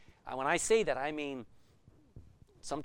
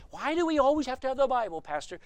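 lightly voiced churchgoer, higher and quicker; questioning congregant; 7 semitones higher, 4 dB louder, faster than usual. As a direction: put a raised, hurried challenge to the pastor. Acting out a congregant questioning the pastor.

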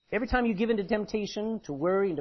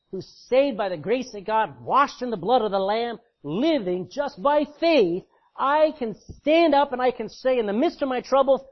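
rising preaching shout; 5 semitones higher, 7 dB louder than usual. Real, not acted. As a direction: build from loud to a full preaching shout. Preacher building to full force.

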